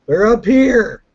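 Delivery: high, loud yell down the stairs. Acted. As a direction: shout it to the guards below. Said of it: "So I yell down the stairs".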